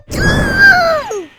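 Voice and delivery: big, high, loud cartoon sneeze. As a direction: let out a huge achoo.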